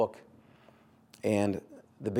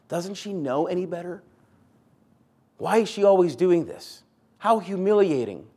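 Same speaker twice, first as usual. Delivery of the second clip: high, louder mock outrage; rant, higher and louder, humiliated by her sister; exasperated sister; 6 semitones higher, 4 dB louder than usual. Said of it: "She's thinking about what Jesus is thinking".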